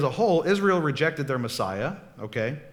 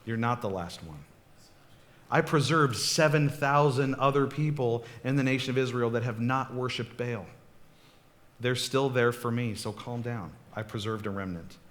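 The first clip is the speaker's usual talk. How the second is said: calm reassurance; 2 semitones lower than usual. Retold or imitated. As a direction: settle a frantic prophet gently. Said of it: "And God says to him".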